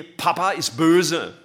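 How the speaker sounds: put-on high, loud, slow child's complaint; sulky child. Small child complaining to mum, loud and high. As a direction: whine it like a child denied dessert. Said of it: "Kleine Kinder sagen".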